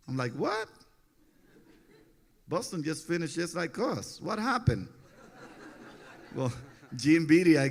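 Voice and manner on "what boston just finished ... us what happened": much higher, stunned disbelief; shocked. Retold or imitated. Pedro reacting with disbelief to his trade to Boston. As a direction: squeak it out in shock.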